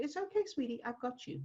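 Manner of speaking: soft, high, soothing voice; soothing a child. Acted. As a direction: gently reassure a frightened child. Soothing a frightened child, acted.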